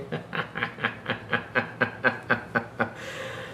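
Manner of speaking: long wheezing laugh at chat; breathless laughter. Real, not acted.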